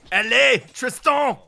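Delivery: loud, high mock-French scolding; playful French yell, stressed final syllable; mock angry dad. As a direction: shout it like a hammed-up French dad. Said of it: "when he gets really mad at you".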